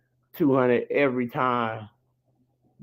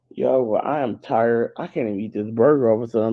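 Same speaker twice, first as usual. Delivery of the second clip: normal talking voice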